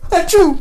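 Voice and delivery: loud hammed-up sneeze; fake sneeze. A narrator's reading. Kids faking sickness to Billy, fake sneeze. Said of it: "faking sneezes".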